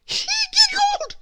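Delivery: high, loud tearful wail; bursting into tears. A narrator's reading. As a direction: sob it out, breaking into tears. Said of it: "he burst into tears".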